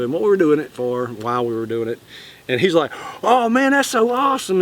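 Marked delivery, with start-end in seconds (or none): 3.2-4.6 high, impressed gush; mildly hammed-up admiration; imitated amazement. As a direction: retell someone's wowed reaction, pitched up. Retold impressed reaction of bystander.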